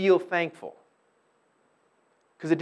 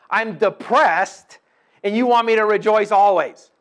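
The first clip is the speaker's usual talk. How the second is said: mock indignant complaint; 5 semitones higher, 8 dB louder than usual. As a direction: push back as a sulking listener. Voicing a listener's objection.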